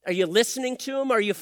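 normal talking voice

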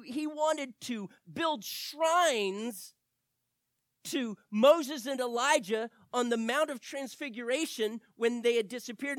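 ordinary talk, nothing marked

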